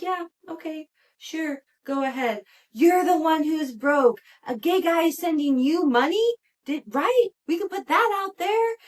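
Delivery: high, loud, smug brush-off; a mocking impression of a smug husband waving her off; mock unbothered.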